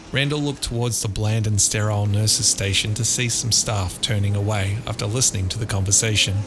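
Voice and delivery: ordinary delivery, nothing marked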